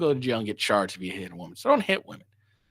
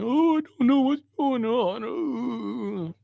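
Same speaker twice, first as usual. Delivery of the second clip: high, slow, whiny mimicry; mocking whiny quote; 8 semitones higher, much slower than usual. Streamer mimics the woman in the video, high whiny mockery. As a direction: drag it out like a clueless victim, high and mocking. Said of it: "be wondering".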